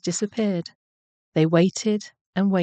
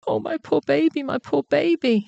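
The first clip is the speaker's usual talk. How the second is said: high-pitched distraught mother rabbit; frantic wail; 9 semitones higher than usual. A narrator's reading. Mother rabbit frets for her missing baby, wailing frantically. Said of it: "Missus Rabbit was frantic".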